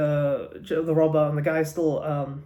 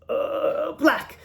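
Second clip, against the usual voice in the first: panicking; 11 semitones higher than usual. Acted. Confused guy in the bar answering police, panicking. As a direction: act a flustered witness, voice shooting up.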